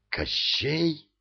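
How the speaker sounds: high-pitched startled question; gasped astonishment. A narrator's reading. Ilya recognises whose ring it is, astonished. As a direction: ask it astonished, pitch lifted. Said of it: "ахнул Илья".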